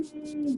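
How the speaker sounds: high-pitched wordless squeal; little falsetto cry; high fleeing noise. Acted. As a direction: squeal as the figure takes off. Imitates a figure running off.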